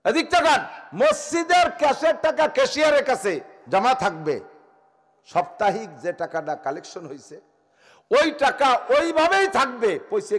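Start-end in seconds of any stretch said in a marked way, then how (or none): 0.1-4.4 high, loud preaching cry; fiery sermon. Acted. Preacher thundering at the mosque committee, loud and high. Waz preacher at full force. 8.1-10.4 high, loud preaching cry; fiery sermon. Acted. Preacher thundering at the mosque committee, loud and high. Waz preacher at full force.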